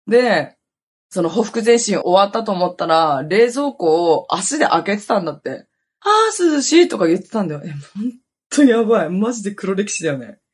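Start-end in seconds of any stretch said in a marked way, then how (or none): 6.0-6.9 mock drunken relief. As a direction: act out your tipsy self cooling off, pitched way up. 酔って冷蔵庫を開けた自分の再現. (酔った自分の「あー涼しい」を高い声で再現)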